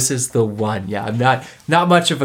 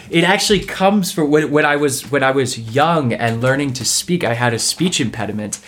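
ordinary delivery

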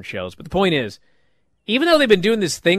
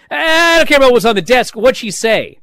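higher and louder, impatient and eager; 8 semitones higher, 7 dB louder than usual. Retold or imitated. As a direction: blurt it out, dying to know. Eager guy asks about call.

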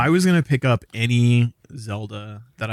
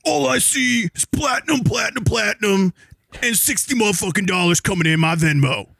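high shouting wrestling heel voice; wrestling promo; 11 semitones higher than usual. Pretend. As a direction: roar it like a ring announcer hyping a fight. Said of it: "I need you to make a wrestling promo now".

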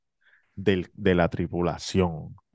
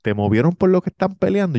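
curious seatmate; 9 semitones higher, 4 dB louder than usual. Retold or imitated.

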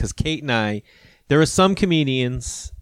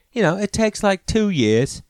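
put-on Australian accent; 3 semitones higher than usual. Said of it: "I can't do an Australian accent".

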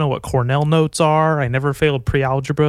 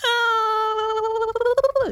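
put-on very high, soft cooing baby talk; gushing pet voice, much higher and softer; baby talk to dog; 3 dB quieter, slower than usual. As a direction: coo at the dog like a tiny baby. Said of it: "I'm just like".

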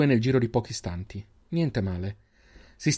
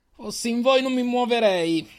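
raised high menacing voice; threatening call; 12 semitones higher than usual. Acted. Extris leader threatening the captives, high menacing call. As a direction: warn them off from a distance. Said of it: "urlò un altro".

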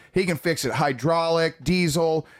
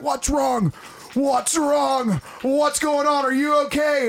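panicked dad; 10 semitones higher, slower than usual. Retold or imitated. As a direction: rush out shouting like a scared dad. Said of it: "He's like".

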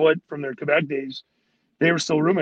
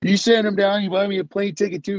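higher-pitched, heated retelling; mock indignant outburst; retold confrontation; 4 semitones higher than usual. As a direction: imitate a teammate going at the coach. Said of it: "he went over the desk at Harley".

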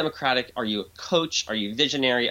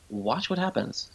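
normal talking voice

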